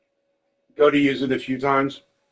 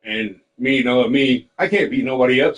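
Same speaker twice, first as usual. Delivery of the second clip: usual speaking voice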